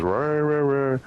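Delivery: mock EVP wind-up sound; winding-up noise. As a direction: imitate a garbled "rah" winding up in pitch. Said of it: "you can hear it wind up".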